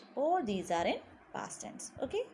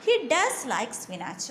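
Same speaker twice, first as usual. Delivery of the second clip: does said louder; stress on does; 4 dB louder than usual. Shown as a demonstration. Teacher giving a surprising fact, stress on does. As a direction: punch the word does. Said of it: "We are stressing there".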